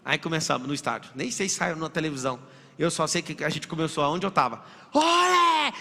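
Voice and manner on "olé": high, full-voiced stadium chant shout; grito de torcida. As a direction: belt it out like a fan in the stands. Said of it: "E eu gritando". (grito de olé bem alto e agudo)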